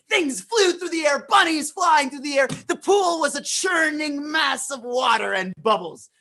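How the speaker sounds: high, loud battle storytelling; excited narration. A narrator's reading. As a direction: build the fight with excitement.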